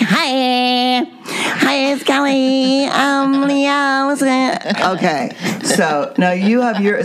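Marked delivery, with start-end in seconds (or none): 0.0-4.8 high, whiny mock phone voice; imitation de Kelly au téléphone. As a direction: parody a caller with long high vowels. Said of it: "if she gets on the phone, it's like".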